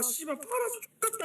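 drawn-out high frustrated curse; slow, high exasperated swearing. Real, not acted. Frustrated after being sold.

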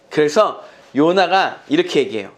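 ordinary delivery

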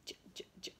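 "j" whispered, very soft; whispered sound. Shown as a demonstration. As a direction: breathe the "j" sound. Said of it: "we've got a".

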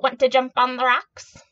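loud, lightly voiced goat; goat character. A narrator's reading. Goat inviting the spider onto the rocks, lightly voiced and loud. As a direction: call out a loud, playful invitation. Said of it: "said the goat".

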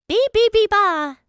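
stuttering on repeated syllables; nervous stammer. A narrator's reading. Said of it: "the minions stammered".

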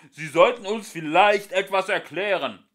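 high, loud squawking penguin; bird rage squawk. Acted. Ford squawking at the ship's voice in bird rage. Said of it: "quäkte Ford in einer Vogelwut".

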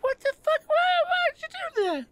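very high-pitched mock outrage; hammed-up, high squeaky protest; imitated pissed-off woman. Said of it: "Oh, she's pissed!".

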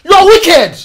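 loud, accusing shout; furious yell. Acted. Husband shouts at his wife in the quarrel, furious yell. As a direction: hurl the words at her, outraged.

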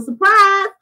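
high, bright sing-song reveal with a laugh; playful surprise. Real, not acted. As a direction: tease the viewers with the news, half singing. Reveals she is on YouTube.

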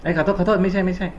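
high, startled "oops, sorry, no no"; startled teacher taking back a wrong answer; sudden self-correction. Real, not acted. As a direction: catch yourself mid-mistake, quickly. Teacher catches own mistake.

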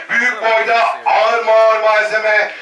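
normal talking voice

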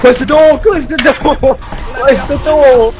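frantic yelling. Real, not acted. Fleeing monsters, yelling.

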